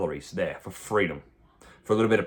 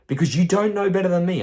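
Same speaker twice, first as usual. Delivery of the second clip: loud, higher, scolding the client; raised scolding; 7 semitones higher, 5 dB louder than usual. Real, not acted. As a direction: put them in their place, loud and high.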